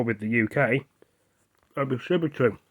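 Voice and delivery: talking with a mouthful; mouth full. Real, not acted. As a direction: speak through a mouthful of pasta. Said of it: "I'm sorry if I'm eating my mouthful".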